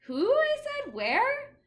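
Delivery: high, loud puzzled questions; puzzled child. Acted. Child baffled by the teacher's comparison, high and loud. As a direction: blurt out confused.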